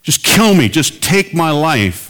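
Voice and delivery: rising anguished plea, slow and pleading; desperate cry to God. Retold or imitated. Minister crying out to God in his car, anguished plea. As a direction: beg God to end it, voice cracking upward. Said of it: "He just blurted out".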